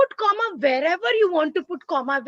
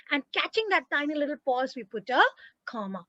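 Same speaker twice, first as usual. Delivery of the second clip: ordinary delivery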